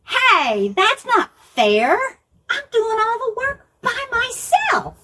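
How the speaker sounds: mock-annoyed storybook sister; complaining Martha. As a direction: protest it's not fair. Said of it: "And she said".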